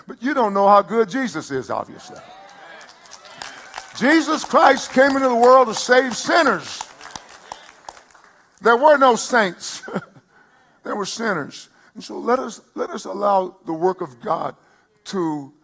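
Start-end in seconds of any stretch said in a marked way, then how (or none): 4.0-11.7 loud, high preaching peak. Acted. Pastor lifting his voice to the congregation, preaching loud. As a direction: proclaim it to the whole church.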